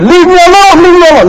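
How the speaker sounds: hammed-up tyrant straining against nobody; Pharaoh blustering. Pharaoh to his court, hammed-up bluster. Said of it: "He said".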